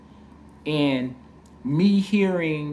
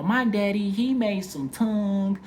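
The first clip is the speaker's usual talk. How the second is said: lightly voiced girl, high and drawn out; higher-pitched mimicry of a proud daughter; imitated teen girl; 7 semitones higher than usual. Teen girl bragging about her dad.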